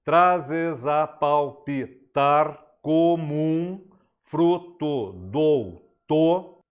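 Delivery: slow, drawn-out scansion of the verse; syllable-by-syllable scansion. Shown as a demonstration. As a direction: stretch each poetic syllable apart to count it. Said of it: "com a divisão poética".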